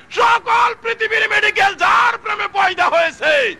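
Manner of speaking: high, loud rhetorical cry; impassioned sermon shout; full-force preaching. Real, not acted. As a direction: preach it out on a high, sustained pitch.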